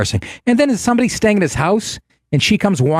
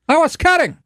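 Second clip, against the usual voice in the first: much higher Scarface-style line; 13 semitones higher than usual. Retold or imitated. Quoting Scarface to cohost, high gangster voice. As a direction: do Tony Montana pleading. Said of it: "That's Scarface".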